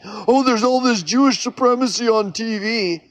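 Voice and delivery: lightly voiced know-it-all, smug and sure; mock conspiracy believer. Mocking a conspiracy believer who claims he saw it. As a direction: play the believer who has it all figured out.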